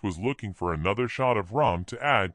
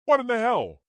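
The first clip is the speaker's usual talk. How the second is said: much higher, a startled, horrified cry; shocked; 13 semitones higher, 3 dB louder than usual. A narrator's reading. Agnes to Ashley on finding the bodies, horrified. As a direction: blurt it out in horror.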